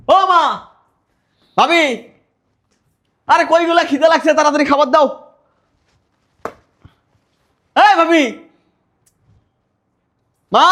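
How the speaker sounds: loud, high-pitched calling through the house; yelling. Acted. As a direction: holler impatiently for food. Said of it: "গরুর মতো চিল্লাইতেছো কেন?".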